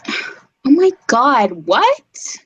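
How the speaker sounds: put-on high, outraged Valley Girl accent. Mocking the Valley Girl accent, outraged at a friend. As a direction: shriek it like an offended Valley Girl. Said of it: "That's a pretty good Valley Girl accent".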